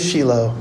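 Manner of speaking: Shiloh said as SHEE-loh; Shiloh with an ee sound, not eye; Hebrew pronunciation. Shown as a demonstration. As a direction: say Shiloh with a long ee first. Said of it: "it's really Shiloh".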